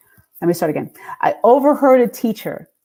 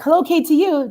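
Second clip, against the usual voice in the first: chirped phone answer; 8 semitones higher than usual. Retold or imitated. Re-enacts answering station phones.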